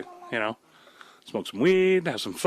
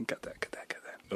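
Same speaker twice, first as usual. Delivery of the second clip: whispered aside; 12 dB quieter than usual. Real, not acted. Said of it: "I'll just cut that anyway".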